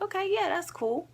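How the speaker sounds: mock-sweet high yes; high chirpy quote. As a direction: pitch it way up, sweet and easy. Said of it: "I was like".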